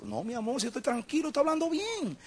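high, soft, tense denial; husband insisting to wife he's calm, voice pinched high; strained stressed denial. Retold or imitated. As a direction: deny being stressed in a tight, high voice. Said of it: "mi tono era un tono de preocupación".

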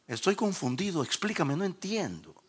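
high, softened plea, acting it out; confused pleading. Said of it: "Temblé porque identifiqué la voz".

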